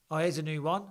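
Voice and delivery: normal talking voice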